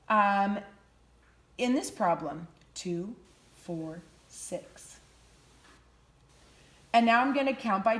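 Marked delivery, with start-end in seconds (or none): 2.7-5.0 counting under breath. Shown as a demonstration. Skip counting softly aloud.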